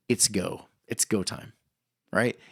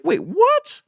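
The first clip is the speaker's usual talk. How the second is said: sudden high-pitched disbelief, louder; shocked wait what; 6 dB louder than usual. Retold or imitated. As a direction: jump up in pitch in mock shock. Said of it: "And he's like".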